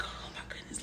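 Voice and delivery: hushed, breathless whisper. Retold or imitated. Scared teen at the door, whispering in panic. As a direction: barely breathe the words out. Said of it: "I'm like".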